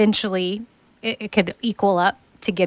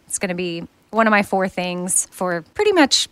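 usual speaking voice

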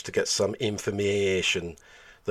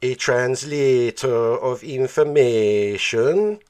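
slow, drawn-out, louder mocking echo of the channeler; mock alien channeler; 3 semitones higher, 6 dB louder, much slower than usual. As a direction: parrot his words back slowly, taking the mickey. Narrator mockingly echoes the channeler.

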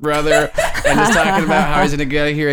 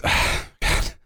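breathy, near-whispered ah God; exhausted breathy groan; sighing groan; 4 dB quieter than usual. Acted. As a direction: let it out as a tired sigh. Prisoner NPC sighs.

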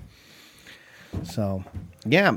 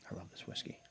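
whispering; 23 dB quieter than usual. Real, not acted. Savoring whiskey softly.

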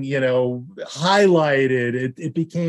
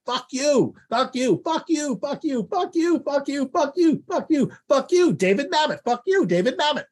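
much higher screamed parroting of fuck you; mock screamed line reading chant; screamed repetition; 13 semitones higher than usual. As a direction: shriek it over and over, high. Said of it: "he now begins just screaming".

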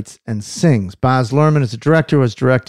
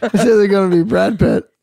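repeating the pick while laughing; trying to stay serious and failing; laughing through it; 9 semitones higher than usual. Real, not acted. Guest repeats his Brad Pitt pick while laughing. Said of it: "He's so dead serious".

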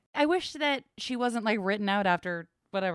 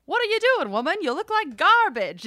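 put-on high, loud old movie man; 10 semitones higher, 6 dB louder than usual. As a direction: snap like a fifties film hero.

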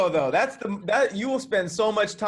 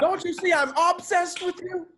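higher, laughing take on "obsessed" as a dramatic movie line; mock movie line; 9 semitones higher than usual. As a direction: ham it up like a bad film scene. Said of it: "I love [name], but her and Obsessed is some of the worst acting".

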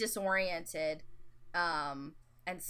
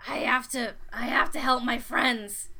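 desperate; 4 semitones higher, 6 dB louder than usual. Acted. Desperate character.

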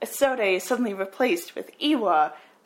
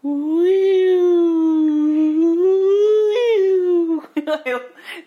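loud, high wailing string screech with a voice crack; imitated screeching strings; 9 semitones higher, 10 dB louder than usual. Copying the spooky movie score, loud cracking wail. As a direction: mimic the shrieking horror soundtrack. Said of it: "Nice voice crack".

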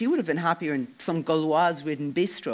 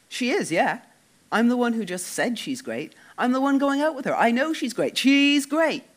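irritated insistence; 9 semitones higher, 5 dB louder than usual. Acted.

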